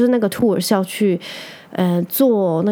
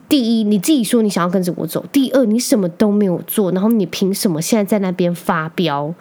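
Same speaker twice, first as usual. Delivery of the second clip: ordinary delivery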